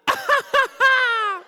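high, loud mock laughter; imitated belly laugh. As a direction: imitate Dad laughing in midair. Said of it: "he's laughing".

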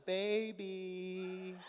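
soft, drawn-out wheedling; mock-sweet pleading; sweet-talking. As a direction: coax like a wife making up. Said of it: "try to get on your good side".

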